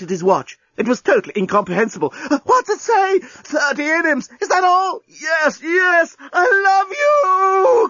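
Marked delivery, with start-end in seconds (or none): 2.4-7.9 very high ecstatic cries; climactic crying out. A narrator's reading. Lucy at the climax as time runs out, crying out. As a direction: build to a long, drawn-out wail. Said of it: "cried Lucy".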